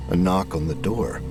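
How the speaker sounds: ordinary delivery, nothing marked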